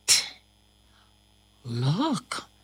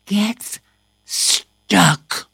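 ordinary delivery

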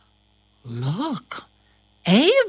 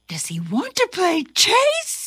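a touch higher, lightly voiced cat; 9 semitones higher than usual. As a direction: ask eagerly as the kitten. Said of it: "says Itty Bitty".

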